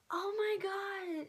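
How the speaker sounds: high, slow, stretched-out gasp of words; awed shock. Retold or imitated. Fan as Mama Swift passes her row, retold awe. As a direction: draw it out high in disbelief.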